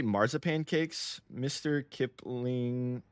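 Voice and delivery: marzipan cakes drawn out very slowly; slow, deliberate reading of a product name; slow careful repeat. Shown as a demonstration. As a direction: spell it out word by word, slowly. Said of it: "What did you say?".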